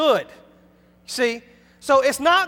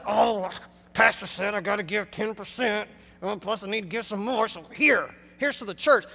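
low, muttered grumble of reluctance; mock reluctant compliance; grudging giver; 5 semitones lower, 5 dB quieter than usual. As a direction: act out giving grudgingly, softer and lower. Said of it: "not reluctantly".

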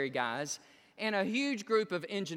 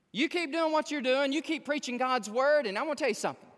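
imitating an earnest older churchgoer, pitched up; serious urging; 7 semitones higher, 5 dB louder than usual. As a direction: urge the preacher on, voice rising and louder. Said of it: "'Cause she was serious".

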